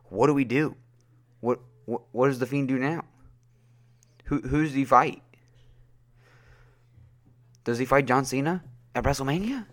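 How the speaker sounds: mildly panicked bafflement. Retold or imitated. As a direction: replay your worried texts with rising pitch. Acts out his baffled texts to friend.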